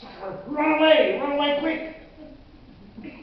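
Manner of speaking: high, loud warning from an inner alarm; screaming. Acted. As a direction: yell an urgent warning. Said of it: "this voice on my shoulder screaming".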